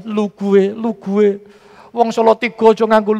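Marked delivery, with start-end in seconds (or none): 0.1-1.4 lightly mimicked Jakarta "lu gue"; mock Jakarta slang. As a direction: tease a Javanese putting on Jakarta talk. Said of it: "Mungkin sudah".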